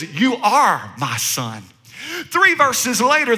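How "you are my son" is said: much lower, slow and tender declaration; voice of God. Acted. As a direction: say it slowly like a proud father. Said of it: "God says".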